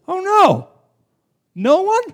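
sudden hammed-up horror; dismayed alarm. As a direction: gasp at the thought. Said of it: "you should think when you read that".

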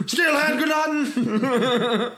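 slow, laughing mock German shout. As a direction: bark it like a movie villain. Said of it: "he starts screaming".

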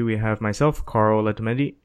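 usual speaking voice

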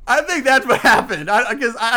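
ordinary talk

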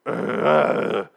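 long, hoarse wordless growl; exaggerated throaty croak, no words; Daryl's raspy groan. Daryl answers Dwight with only a hammed-up raspy growl. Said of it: "then Daryl's like".

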